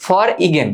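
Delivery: for igen with the g sounded; spelling pronunciation. Shown as a demonstration. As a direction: say for igen as spelt, "for-i-gen". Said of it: "আমি এভাবে মনে রাখতাম".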